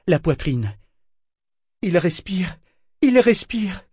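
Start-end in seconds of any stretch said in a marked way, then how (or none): 1.8-2.6 high-pitched hopeful cry; anguished joy. A narrator's reading. Eta kneeling by Kénit, anguished joyful cry. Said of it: "s'écria-t-elle, saisie d'une joie angoissée". 3.0-3.8 very high, louder cry; anguished joy, mounting. A narrator's reading. Eta finds Kénit breathing, cries out in rising joy. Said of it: "s'écria-t-elle, saisie d'une joie angoissée".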